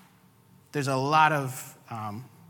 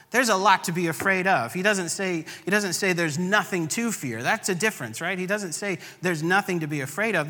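higher-pitched, intense pulpit preaching; fervent preaching; 6 semitones higher, 4 dB louder than usual. Acted. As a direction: preach it up with rising conviction.

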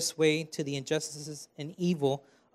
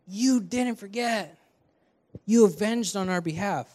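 acted praise; 4 semitones higher, 3 dB louder than usual. Martyrs praising God for avenging them, acted praise. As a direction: exclaim it as grateful praise. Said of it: "give God a praise like".

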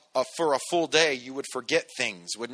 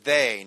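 "they" set apart with a louder hit; stress on they; 3 semitones lower, 4 dB louder than usual. Shown as a demonstration. Preacher contrasting "they" with the church. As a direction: lean on "they", not us. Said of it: "not us or some among you".

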